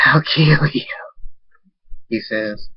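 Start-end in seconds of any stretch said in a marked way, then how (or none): 0.1-0.9 a breathy, menacing whisper; threatening whisper. Acted. Nightstorm threatening David in a menacing whisper. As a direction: hiss the threat.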